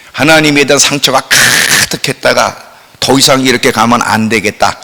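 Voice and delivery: ordinary delivery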